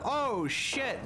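exaggerated high-pitched shock; imitated startled enemy. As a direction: mimic someone caught off guard. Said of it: "This man is like".

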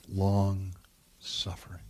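whispered, drawn out. Acted. Preacher hushing the key word to the congregation, whispered. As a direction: let the word fall to a slow whisper.

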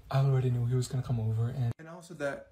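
normal talking voice

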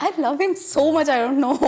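high, delighted laugh into gushing. Real, not acted. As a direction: laugh then gush slowly. Gushing laughter about Yogi Babu.

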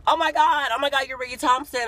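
very high, loud starstruck gushing; fan freaking out. Retold or imitated. Fan recognizes Ricky at the Panera drive-through, freaking out. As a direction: imitate an overexcited fan squealing in recognition. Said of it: "She's freaking out and stuff".

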